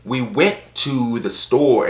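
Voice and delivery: slow, over-enunciated American accent; exaggerated, pointed American narrator accent. Shown as a demonstration. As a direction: hit each word hard, mimicking a narrator's American accent. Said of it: "It's always so pointed".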